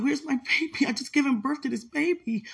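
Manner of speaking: high, anguished crying out; frantic demand. Retold or imitated. New mother screams at family where her baby is, anguished. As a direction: demand the baby back in rising despair.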